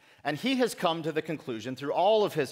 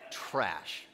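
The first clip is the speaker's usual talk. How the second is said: low, quieter scornful echo of the word Trash; dismissive teen; 9 semitones lower, 6 dB quieter than usual. Retold or imitated. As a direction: toss out Trash like a bored teenager. Echoes the word as teens would.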